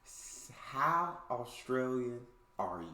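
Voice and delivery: ordinary talk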